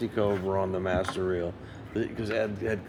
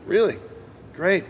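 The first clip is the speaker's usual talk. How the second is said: high, barely contained thrill; squeaky trying-to-be-cool reply; forced calm excitement; 10 semitones higher, 3 dB louder than usual. Retold or imitated. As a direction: act cool while bursting inside. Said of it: "trying to keep it together".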